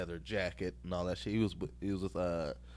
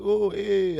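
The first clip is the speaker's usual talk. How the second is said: high, loud "Oh, eh" greeting grunt; comedian greeting grunt; 10 semitones higher, 8 dB louder than usual. Retold or imitated. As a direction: toss out a knowing "Oh, eh". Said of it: "that's how stand-up comedians talk to each other".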